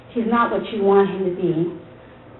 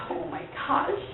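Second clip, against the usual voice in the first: whispered, higher oh my gosh; shocked whisper; 7 semitones higher, 10 dB quieter than usual. Retold or imitated. Wife overwhelmed by the news, whispering. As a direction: breathe it out in disbelief. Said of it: "I'm like".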